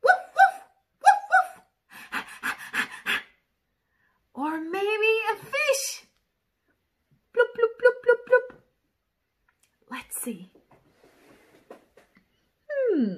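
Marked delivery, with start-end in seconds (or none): 0.0-2.2 high, loud playful woofs; barking dog. Pretend. Dog sound in picture book. 7.4-8.6 bouncy, a bit louder bloop sounds; fish bloops. Pretend. Fish sound in picture book.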